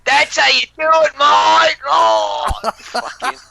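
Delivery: shouted very high and loud, laddish cheer; rowdy British lad. Retold or imitated. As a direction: bellow like a drunk bloke egging mates on. Voicing rowdy British man.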